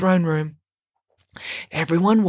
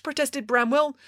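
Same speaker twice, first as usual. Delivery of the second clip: ordinary delivery, nothing marked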